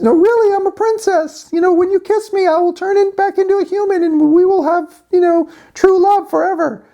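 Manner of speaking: very high falsetto, insistent; frog princess voice. Acted. Frog insists to boy she's princess, high falsetto. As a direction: plead as a squeaky fairy-tale frog. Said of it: "and she says".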